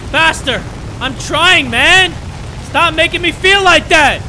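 high, loud protest; frantic, defensive yelling; exasperated shouting. Real, not acted. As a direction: yell back at someone rushing you. Shouting back at game character.

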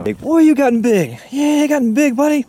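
high delighted praise of a grown cat; pet talk. Real, not acted. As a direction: marvel at how big he got.

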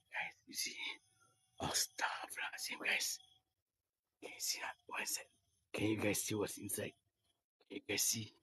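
soft, breathy whispered greeting. Real, not acted. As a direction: hush it so nobody hears. Whispering near people meditating.

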